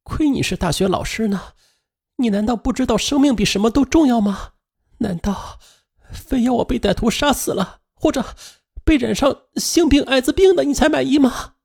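high, hurt voice of the wife. A narrator's reading. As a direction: plead as a wounded wife. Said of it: "彭英很伤心".

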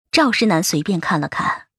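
plain reading voice

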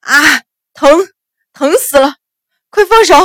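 loud, high-pitched wailing; agonised cries, voice leaping up; howling in pain. A narrator's reading. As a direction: howl and beg to be released. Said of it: "官差大声嚎叫".

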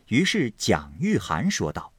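ordinary delivery

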